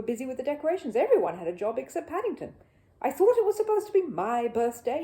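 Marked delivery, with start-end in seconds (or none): 3.0-5.0 louder, higher indignant grumble; grumbling bear. Acted. Paddington sent away again, loud grumble. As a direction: complain you're left out. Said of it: "he grumbled".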